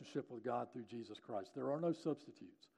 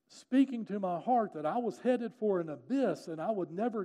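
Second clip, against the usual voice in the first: raised, forceful retelling of a revelation; dramatic retold confession, pitched up; impassioned testimony; 4 semitones higher, 6 dB louder than usual. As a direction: build it with loud, weighted stresses. Daughter's intense retold testimony.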